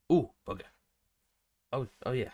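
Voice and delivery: usual speaking voice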